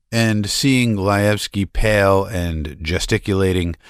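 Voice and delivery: usual speaking voice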